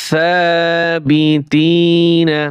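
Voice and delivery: slow drawn-out reading. Shown as a demonstration. Teacher to students, showing how to blend the syllables, slow chanted reading. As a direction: stretch every syllable, very slow and sung out. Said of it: "বানান করে পড়বেন".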